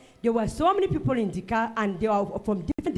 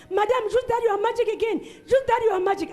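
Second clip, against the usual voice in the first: much higher pleading passenger voice; voiced woman passenger; 10 semitones higher, 3 dB louder than usual. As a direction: play the scared woman begging. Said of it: "she said".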